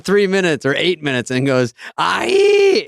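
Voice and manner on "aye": sudden high shouted "Aye!"; short high screamed "Aye!"; Farley scream. Retold or imitated. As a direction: let out a high yelp, Farley style. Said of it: "He's screaming".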